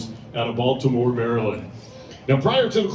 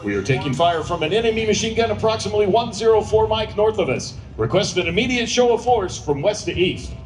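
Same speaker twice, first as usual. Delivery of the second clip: urgent, higher radio calls; military radio controller; 4 semitones higher than usual. Acted. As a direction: bark out the grid like a JTAC. Announcer voices ground controller.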